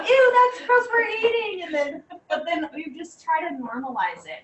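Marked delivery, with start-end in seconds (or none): 0.1-1.6 put-on high, loud disgusted kid voice; grossed-out kid. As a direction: whine like a teen at dinner. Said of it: "some of the kids were like".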